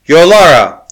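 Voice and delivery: a loud, high name sung out across the distance; ringing call. Acted. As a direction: call her name out sweetly and loudly. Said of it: "The golden voice rang out sweetly".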